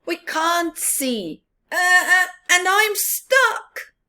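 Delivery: high, slow, strained little fox; stuck fox voice. Acted. Fox voiced in read-aloud story.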